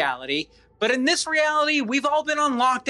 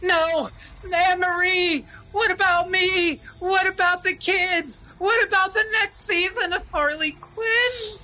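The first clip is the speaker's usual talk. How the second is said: whiny pleading; 7 semitones higher than usual. Acted. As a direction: beg her not to leave. Husband desperate pleading.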